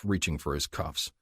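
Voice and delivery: usual speaking voice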